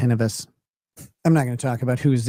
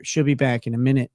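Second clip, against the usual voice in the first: ordinary talk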